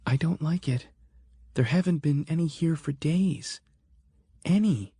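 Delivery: uneasy Sarah. Acted. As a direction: let her unease creep into every word. Sarah voiced, uneasy about the woods.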